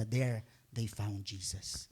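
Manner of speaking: slow, low, hushed reveal; reverent hush. Acted. Preacher revealing the baby Jesus, slow and hushed. Climax of shepherds' story.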